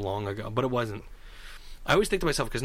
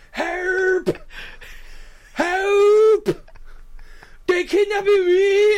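high, loud mock scream for help; imitating a screaming patient; 9 dB louder, much slower than usual. Patient yelling from the hospital window, hammed-up scream. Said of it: "he was screaming out the window".

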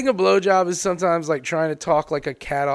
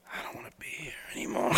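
tiny whisper, drained; hushed mock regret; 19 dB quieter, much faster than usual. Right after sex, hushed mock regretful whisper. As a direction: whisper it as if wanting to vanish.

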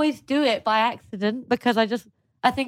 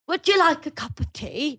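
mock English accent; 5 semitones higher than usual. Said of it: "Sometimes they go".

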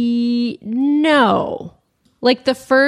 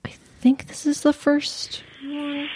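whisper; 5 semitones higher, 6 dB quieter than usual. Real, not acted. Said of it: "aside while message plays".

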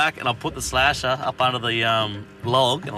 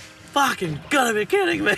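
disbelief. Retold or imitated. Retold disbelief at smashed glass.

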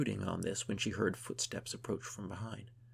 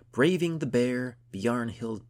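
loud, declaimed poetic line; bellowed verse; 5 semitones higher, 9 dB louder, slower than usual. A narrator's reading. Gunhild composes her friend's heroic poem, bellowing. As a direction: bellow it like a bard's opening line.

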